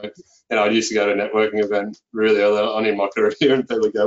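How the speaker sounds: ordinary talk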